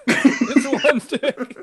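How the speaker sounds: slow, laughing emphasis; laughing slowly. Real, not acted. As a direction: stretch it out laughing.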